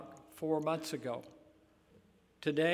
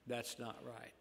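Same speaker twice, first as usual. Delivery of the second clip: quiet repeat; 5 semitones lower, 12 dB quieter than usual. Real, not acted. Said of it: "repeated softer".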